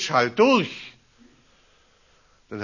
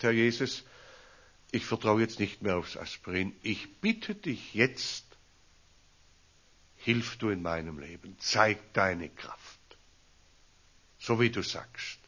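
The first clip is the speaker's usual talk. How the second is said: quiet retold prayer; 4 semitones lower, 6 dB quieter than usual. (leises, langsames Gebet)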